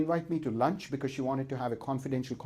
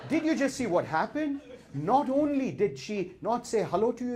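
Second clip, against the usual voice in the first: furious rant; 5 semitones higher than usual. Retold or imitated. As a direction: rant like an outraged inner monkey. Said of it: "Curious George became Furious George".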